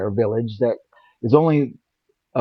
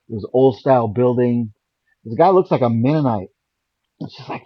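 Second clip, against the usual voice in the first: normal talking voice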